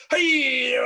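hammed-up overexcited hey; over the top. Shown as a demonstration. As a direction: blast it out way too excited. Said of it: "so expressive and over the top".

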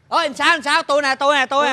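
high, loud delighted calling; excited greeting. Acted. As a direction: call out eagerly to an old friend. Recognises old friend, calls out.

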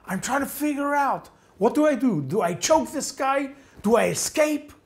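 high-pitched, agitated self-questioning; frantic indecision. Retold or imitated. Narrator deciding what to do facing a war criminal, frantic indecision.

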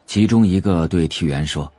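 ordinary delivery, nothing marked

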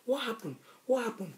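very soft imitation of an anxious question; quiet, concerned quoted questioning; hushed retold concern. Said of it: "she was like".

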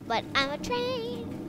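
high, slow, silly train voice; high playful voice. Pretend. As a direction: sing it out like a playful kid.

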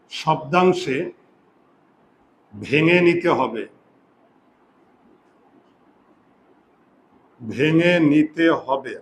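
stretched-out words, slow and measured; slow dictation. Shown as a demonstration. Teacher repeats the rule for students writing it down, slow dictation. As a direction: dictate it slowly for note-taking.

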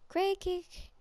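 crane kick squeaked high in a silly put-on voice. Gamer repeating the move name in a high silly voice. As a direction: pitch crane kick way up like a cartoon.

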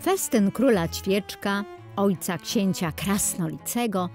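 ordinary delivery

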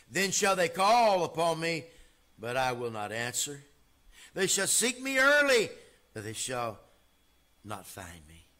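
loud, soaring pulpit reading; full-force preacher reading scripture; preaching scripture. Acted. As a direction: thunder the verse at the congregation. Reads Proverbs at full force.

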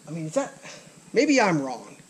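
ordinary talk, nothing marked

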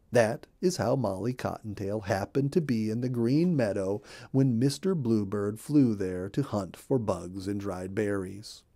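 ordinary delivery

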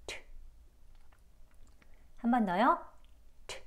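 트 as a bare puffed English t; voiceless 트 with no vowel, just a burst of air; aspirated alveolar 트. Shown as a demonstration. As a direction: say 트 with tongue tip on the ridge, letting air burst out. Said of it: "바람 소리랑 같이 나오는 트 소리가 나요".

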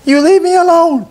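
very high, loud frightened squeak; scared little goblin squeaking at Herschel. A narrator's reading. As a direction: squeak it out in fear. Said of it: "he squeaked".

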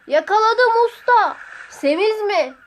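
high, loud drawn-out holler; calling across. A narrator's reading. Sotiri and master call across about the hare, hollering. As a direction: holler the news over a distance.

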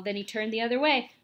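plain reading voice

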